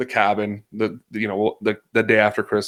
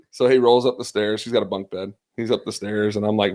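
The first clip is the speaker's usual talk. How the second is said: usual speaking voice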